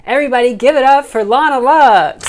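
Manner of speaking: loud, high hype intro; announcer hype. Acted. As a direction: bring the guest on like a showstopper. Host introducing special guest.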